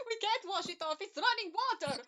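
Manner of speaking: loud, high cry of alarm; panicked shout. Acted. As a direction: shout it in panic, a vampire cornered. Vampire panics at water.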